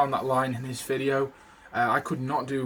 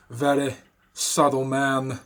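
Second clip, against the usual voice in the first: slow, louder Walken cadence on "Very subtle man"; Walken accent; 5 dB louder, slower than usual. Shown as a demonstration. Showing how the Walken line fluctuates, slow Walken accent. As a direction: stretch "Very subtle man" with Walken's jumpy rhythm. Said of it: "goes from subtle man, like, goes up and down".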